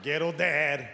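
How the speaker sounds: usual speaking voice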